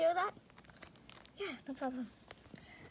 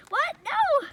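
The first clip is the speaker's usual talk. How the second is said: high, loud protesting no; loud refusal; 11 semitones higher, 6 dB louder than usual. Pretend. Kid refusing to face the cat. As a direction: refuse it outright.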